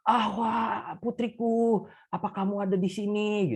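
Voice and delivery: a feeble call to his daughter; the dying father, barely audible; weak dying voice. Acted. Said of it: "dengan lemah juga berkata".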